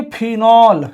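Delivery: slow, deliberate "phenol" for the class; slow teaching pronunciation. Shown as a demonstration. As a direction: say "phenol" slowly so students can repeat it. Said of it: "इसको बोलेंगे". (धीरे-धीरे "phenol" बोलकर सिखाते हुए)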